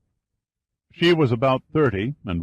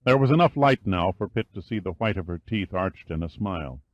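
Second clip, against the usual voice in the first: ordinary delivery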